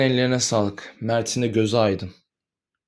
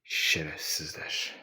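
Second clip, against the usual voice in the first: a bitter whispered curse, barely audible; hissed under the breath, alone; 9 semitones lower, 13 dB quieter, much slower than usual. Acted. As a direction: breathe the insult out, slow and voiceless. Cursing alone after hearing death.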